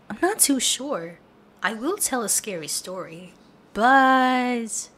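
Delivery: ordinary delivery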